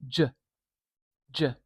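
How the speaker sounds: J said as one unbroken sound; isolated j sound. Shown as a demonstration. Teacher showing J is one sound. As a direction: sound J out on its own. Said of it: "We can't break it down any smaller".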